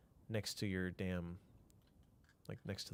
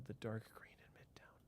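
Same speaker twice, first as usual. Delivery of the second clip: soft whisper while looking; 14 dB quieter than usual. Real, not acted. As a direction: say it under the breath. Repeats chat question again.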